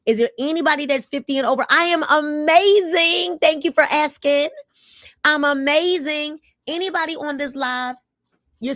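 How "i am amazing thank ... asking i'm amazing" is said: louder, higher and slow, savoring each word; proud delight. Real, not acted. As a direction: beam it out like you truly mean it. Answering viewers' greeting warmly.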